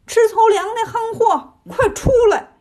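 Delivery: high, drawn-out taunting yell; shouted challenge. A narrator's reading. Sun Wukong yells into the cave for the pig, shouted challenge. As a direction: call him out with a high, stretched shout. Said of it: "喊道".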